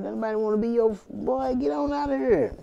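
high put-on voice of a dismissive homeboy; mocking street friend. Reader roasting the ex as a friend, high mocking voice. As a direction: clown on him like your boy would.

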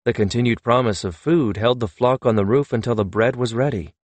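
plain reading voice

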